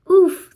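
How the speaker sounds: loud pained grunt; pained oof. Acted. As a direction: grunt as if hit. Hit by hockey puck.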